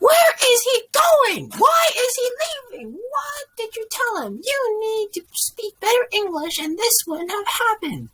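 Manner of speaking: high shriek easing into high-pitched scolding; outraged mother. A narrator's reading. Mother rages as boss walks away, shrieking then scolding.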